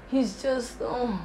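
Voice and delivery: soft, weary mock grumble to friends; acted-out put-upon moan; burdened complaint.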